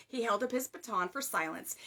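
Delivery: ordinary delivery, nothing marked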